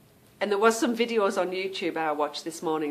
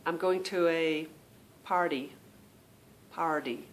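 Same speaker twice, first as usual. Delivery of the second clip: low American "party" as "par-dee"; attempted American accent; 3 semitones lower, 4 dB quieter than usual. Demonstrating for viewers, American "party" with a D. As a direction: put an American soft D in "party". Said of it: "There's a D in there".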